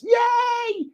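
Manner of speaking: very high squeal of delight; mock cheer. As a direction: cheer like a kid getting treats. Mock celebrating duty free.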